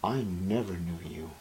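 very low, hushed pronouncement; Jesus rejecting them. Acted. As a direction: drop right down to a grave near-whisper. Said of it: "Jesus will look at them and say".

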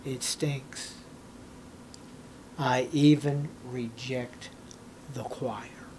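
low, quiet, grave voice of God. Acted. Preacher voicing God rejecting the praising choir, low and quiet. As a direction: speak softly as God, slow and heavy. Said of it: "And what does God say?".

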